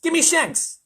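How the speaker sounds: loud, very high outburst; furious controller shouting; angry shout. A narrator's reading. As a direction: shout down a madman. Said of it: "shouted ground control".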